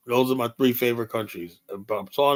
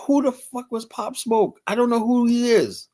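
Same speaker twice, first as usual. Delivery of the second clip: baffled inner outburst; 11 semitones higher, 3 dB louder than usual. Acted. Inner thought, baffled about rapper.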